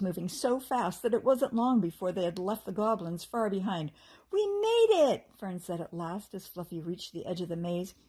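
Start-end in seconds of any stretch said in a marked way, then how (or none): 4.3-5.3 excited cheer. A narrator's reading. Fairy escapes the maze.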